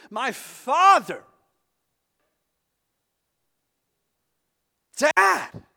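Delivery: loud, slow, high cry of anguish; desperate plea. Acted. Jesus begging his father in the garden, anguished cry. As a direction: beg your father from the depths. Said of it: "Jesus pleads".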